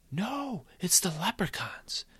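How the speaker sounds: put-on high, panicked child's cry; a little boy seeing the leprechauns coming; boy alarmed at chasing leprechauns, high-pitched; frightened boy. Boy Michael cries out in fright.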